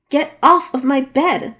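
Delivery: loud, slow, furious command off the bed; angry order. Acted. Danielle ordering Alex off her bed, loud and angry. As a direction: shout him off the bed, word by word.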